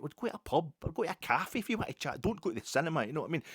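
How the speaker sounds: high mocking voice of a chatterbox; mock chatty cinemagoer.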